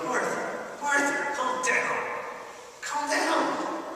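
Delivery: tender Jesus calming Martha; soothing Jesus. Retold or imitated. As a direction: soothe her like a friend, hand on her shoulder. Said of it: "I can just imagine Jesus saying".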